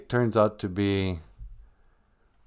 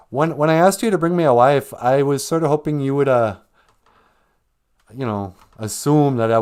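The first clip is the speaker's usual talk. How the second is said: loud, higher excuse-making; blaming Adam; 4 semitones higher, 5 dB louder than usual. Acted. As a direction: shift the blame onto his wife. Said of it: "He goes".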